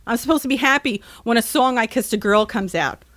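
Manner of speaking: normal talking voice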